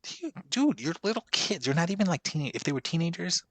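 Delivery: incredulous. Real, not acted. Arguing with his friend, incredulous.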